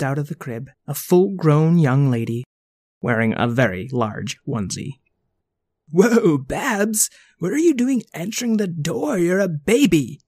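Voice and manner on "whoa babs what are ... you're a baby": surprised. Acted. Shocked at baby answering door.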